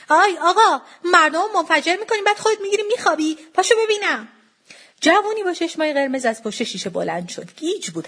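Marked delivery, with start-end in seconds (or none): 0.1-4.3 high, loud indignant yell; shouting. A narrator's reading. Mother shouting into a kiosk at the seller, indignant yell. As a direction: shout a sleeper awake. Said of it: "سرک کشید توی دکه و داد زد".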